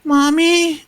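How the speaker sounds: very high, small voice calling out; raspy soft voice. Acted. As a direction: call for mother in a soft, high rasp. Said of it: "this raspy, soft voice saying".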